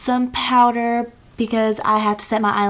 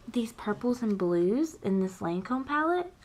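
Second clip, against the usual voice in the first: soft excited whisper; hushed whisper over a palette; 9 dB quieter than usual. Real, not acted. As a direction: whisper it like a secret to the camera. Secret excited makeup confession.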